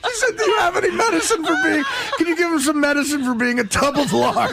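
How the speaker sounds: high, laughing retelling; squeaky from cracking up; helpless laughter. Real, not acted. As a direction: fight through real giggles to finish.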